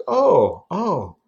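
high, drawn-out mock apology; retold sheepish apology, high and slow; flustered apology.

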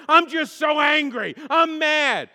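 high, loud, drawn-out mock outburst; over-the-top imitation of venting; hammed-up angry shout. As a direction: belt out the anger for comic effect. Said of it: "often the Italians would say things like".

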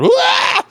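sudden high yelp of a ghost; jump-scare wah, way up and loud; mock ghost shout. Spirit popping out on the witch, high mock shout.

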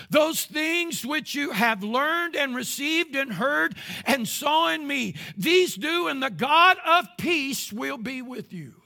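rising preacher's declamation; preached emphatic reading. Acted. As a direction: thunder the verse out. Loud, slow second reading.

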